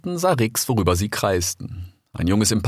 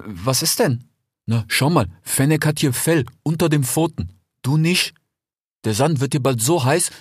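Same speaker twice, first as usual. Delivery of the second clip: put-on a slightly higher, friendly young fox; lightly voiced fox; 6 semitones higher than usual. A narrator's reading. Desert fox talking to his brother Rix, lightly voiced. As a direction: play the eager desert fox.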